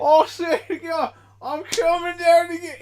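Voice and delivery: high, loud mongrel British-Australian accent; Mud Brit accent. Shown as a demonstration. Proving it is Mud Brit, loud high put-on accent. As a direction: belt out a made-up muddy British accent. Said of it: "it's Mud Brit".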